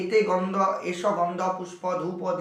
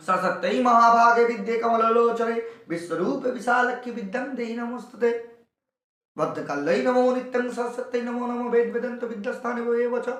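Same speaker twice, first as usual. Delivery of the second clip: sustained, even-toned mantra chant; prayer recitation; 4 semitones higher than usual. Shown as a demonstration. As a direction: intone the Sanskrit verse without pausing between words. Recites second Saraswati prayer. (টানা সুরে মন্ত্র পাঠ)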